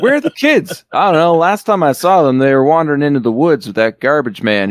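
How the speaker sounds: high-pitched mock bystander voice, settling down; put-on accent. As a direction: start high in an odd, unplaceable accent. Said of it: "What accent are you doing?".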